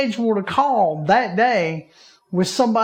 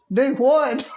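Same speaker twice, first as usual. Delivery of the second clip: put-on Texas accent; 7 semitones higher than usual. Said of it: "'cause she's in Texas".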